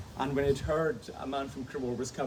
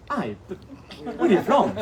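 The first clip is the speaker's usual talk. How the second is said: higher, louder retold pressing question; insistent questioning; 8 semitones higher, 8 dB louder than usual. Kayaker pressing a fellow Scot on her home, insistent. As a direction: press for a more exact answer, half laughing.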